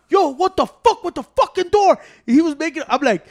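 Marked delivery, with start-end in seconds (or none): 0.1-2.0 high, loud angry dad; retold yell. Porfi yelling, retold.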